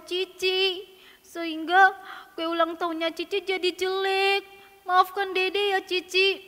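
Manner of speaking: put-on slow, high, drawn-out child whine; contrite apology. Keke apologising to big sister, whimpering and slow. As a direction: plead sorrowfully for forgiveness. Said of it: "Keke minta maaf kepada cicinya".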